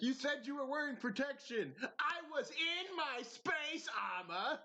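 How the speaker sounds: high-pitched comic protest, slowing at the end; mock indignant quarrel. Acting out cartoon couple's argument.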